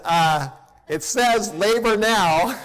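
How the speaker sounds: slow, half-laughing disbelief; drawn out with a grin; amused slow announcement. Retold or imitated. Pastor tells his congregation the monitor says labor now, amused and slow.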